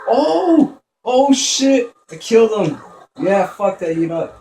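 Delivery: high, loud burst, easing down; thrilled yelling. Real, not acted. Player who just killed the enemy, yelling in excitement. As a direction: shout it out, amazed at the kill. Unexpected kill in game.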